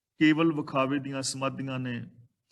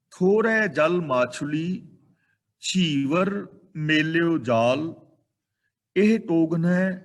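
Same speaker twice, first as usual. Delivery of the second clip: loud, slow chanting of the shloka; verse recitation; 6 dB louder, slower than usual. Acted. Preacher reciting Kabir's shloka to the congregation, loud and slow. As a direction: proclaim the verse slowly to the hall.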